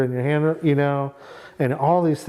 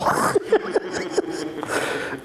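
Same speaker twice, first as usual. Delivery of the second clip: breathy laugh; 4 semitones higher, 3 dB quieter than usual. Real, not acted. Laughs at wife's reaction.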